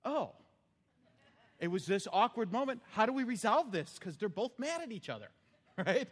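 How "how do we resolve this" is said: ordinary delivery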